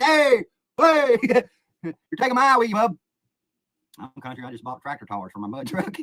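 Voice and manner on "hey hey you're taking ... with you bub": high, loud comic yelling, broken by laughter; retold calling out. Hooked angler to his buddy, retold comic yell. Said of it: "I'm like".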